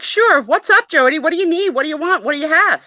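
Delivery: very high, eager rapid-fire questions. Acted. Paula answers Jody's summons, high and eager. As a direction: pipe up high, keen to help.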